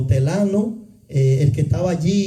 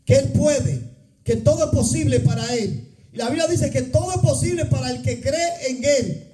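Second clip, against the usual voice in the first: fervent preaching; 6 semitones higher than usual. Acted.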